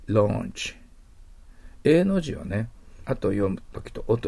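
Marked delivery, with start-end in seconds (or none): 0.1-0.7 ランチ as "lawnch"; long O vowel in ランチ; English "launch". Shown as a demonstration. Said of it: "エーユーはOと覚えてください".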